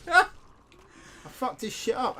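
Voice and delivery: slow, laughing boast; real laughing gloat. Real, not acted. Laughing after accidental kill.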